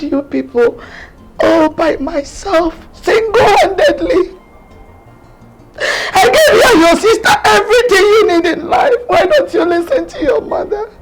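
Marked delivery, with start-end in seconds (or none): 6.1-10.9 crying, begging her son to back off; a mother's desperate tearful plea; tearful pleading. Acted. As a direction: plead through sobs, voice cracking.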